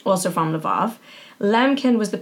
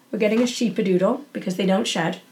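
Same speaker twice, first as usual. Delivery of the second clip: ordinary talk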